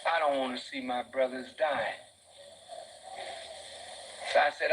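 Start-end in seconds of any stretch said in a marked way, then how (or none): none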